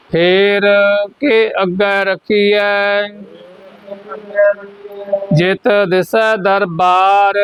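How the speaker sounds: measured chant-like reading with held endings; scripture recitation for repetition. Shown as a demonstration. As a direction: lead the class line by line, drawing out the last vowel.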